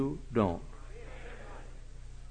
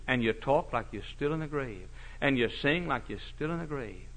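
usual speaking voice

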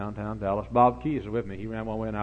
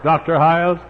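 mock teasing call; 7 semitones higher, 15 dB louder than usual. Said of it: "they'd say".

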